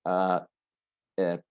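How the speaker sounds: ordinary delivery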